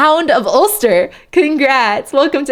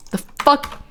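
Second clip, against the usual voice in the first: ordinary talk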